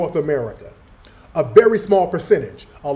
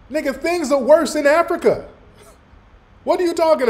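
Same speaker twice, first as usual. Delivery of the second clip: high, scoffing disbelief; mock the guest's idea of a happy history; incredulous rant; 7 semitones higher than usual. Real, not acted. Mocking the guest on his stream, high and incredulous.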